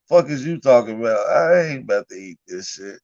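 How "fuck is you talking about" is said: normal talking voice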